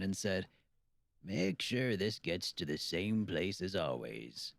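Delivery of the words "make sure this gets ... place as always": slow drawl. Acted. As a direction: drag the words out like a tired old man. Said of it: "In his slow drawl".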